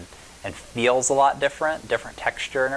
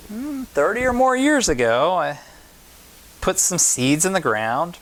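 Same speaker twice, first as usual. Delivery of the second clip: voicing Miss Tilly; 7 semitones higher than usual. Miss Tilly voiced in story.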